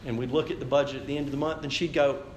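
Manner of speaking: ordinary talk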